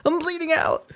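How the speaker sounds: very high, frantic, bleeding out; hysterical dying caller. Retold or imitated. Dying caller begging phone menu for a doctor, very high frantic. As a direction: shriek it like you're dying on hold. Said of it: "They're like".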